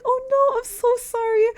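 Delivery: high, squeaky apologetic little voice; sorry for the spider. Retold or imitated. Apologising to the spider he killed, high squeaky voice. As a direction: coo an apology to a squished spider. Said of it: "I was like".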